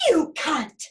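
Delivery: high, loud retort; snapping rabbit. A narrator's reading. Rabbit disputing the bear's claim, snappish. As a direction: snap back in flat denial. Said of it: "snapped the rabbit".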